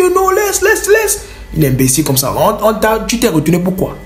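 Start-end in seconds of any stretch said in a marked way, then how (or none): 0.0-1.2 high, dismissive wave-off; imitating the bandit. Bandit waving him off, high dismissive. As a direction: brush him off.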